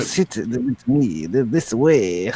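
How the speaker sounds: slow, low, slick maitre d' voice. Acted. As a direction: usher a guest in slowly and slickly. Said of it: "or like a slick".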